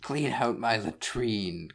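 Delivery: high, slow villain command; pompous cartoon boss ordering an underling; Cobra Commander. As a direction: draw out the order in a screechy villain voice. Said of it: "Cobra Commander will be yelling for you".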